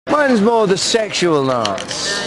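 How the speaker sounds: put-on camp sixties London accent; Austin Powers voice.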